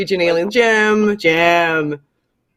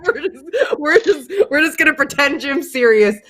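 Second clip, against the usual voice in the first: talking through real giggles, higher-pitched; laughing; 6 semitones higher than usual. Real, not acted. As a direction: struggle to get it out while cracking up. Cracking up, giving up on the prank.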